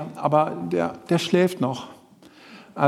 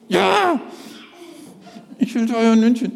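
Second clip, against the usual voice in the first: puppet Willi; 4 semitones higher than usual. Acted. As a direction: insist like a sulking child.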